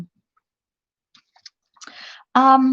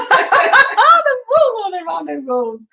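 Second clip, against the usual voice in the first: put-on American accent; 11 semitones higher, 5 dB louder than usual. Host repeats her rules joke, exaggerated high American accent. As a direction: stretch it out in a loud, silly American accent. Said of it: "Was that my American accent?".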